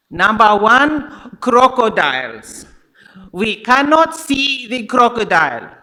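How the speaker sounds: imitating a guide's loud African accent; imitated African accent. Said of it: "I'm not gonna do that accent the whole time".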